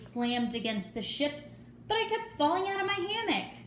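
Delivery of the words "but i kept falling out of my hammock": ordinary delivery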